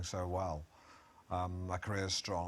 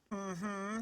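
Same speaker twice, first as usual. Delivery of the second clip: clueless; 11 semitones higher than usual. Retold or imitated. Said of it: "like she didn't have a bloody clue".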